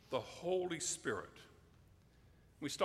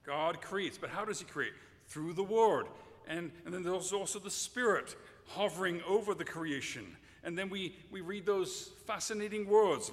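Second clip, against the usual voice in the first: ordinary talk, nothing marked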